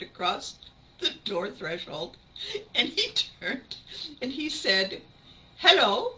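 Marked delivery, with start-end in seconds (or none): 5.6-6.1 put-on high, loud, cheery German-accented farewell; mock German accent, rising at the end. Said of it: "That's the best German accent I've got".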